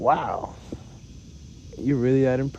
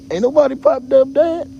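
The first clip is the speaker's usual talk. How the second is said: much higher, much louder mock yell; higher and louder put-on thug scream; mock gangster shout; 10 dB louder than usual. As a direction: bellow it like an outraged tough guy.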